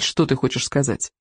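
usual speaking voice

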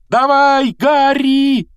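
loud, high-pitched yell; screamed cheer. A narrator's reading. Hermione cheers Harry's dive, screaming. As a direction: shriek him on with everything you have. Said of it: "завопила Гермиона".

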